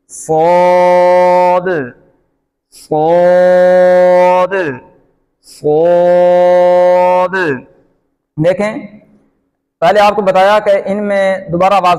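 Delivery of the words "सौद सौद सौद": सौद with a full rounded vowel held long; slow, steady recitation of सौद with echoing final d; heavy, thick saad. Shown as a demonstration. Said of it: "सौद मोटा है". (सौद को मोटा करके खींचकर)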